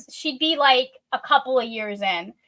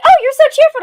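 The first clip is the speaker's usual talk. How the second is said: high, loud gushing; mock delighted squeal; delighted customer; 13 semitones higher, 10 dB louder, faster than usual. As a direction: gush like a charmed customer. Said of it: "people are like".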